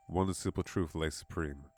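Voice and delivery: usual speaking voice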